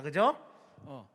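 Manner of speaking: ordinary talk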